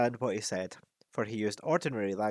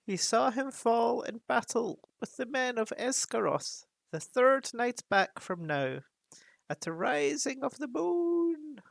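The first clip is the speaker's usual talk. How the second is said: higher, solemn raven voice; old raven; 12 semitones higher, slower than usual. Acted. Raven Roak confirms the dragon's death to dwarves. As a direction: tell the news like a wise old bird.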